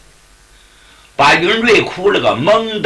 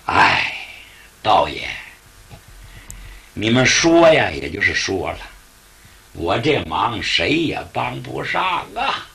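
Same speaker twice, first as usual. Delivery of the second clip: much lower, fading tired sigh; resigned old innkeeper; 6 semitones lower, 4 dB quieter than usual. Acted. Innkeeper tells the Taoist nobody can help, low and resigned. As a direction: let it sink into hopelessness.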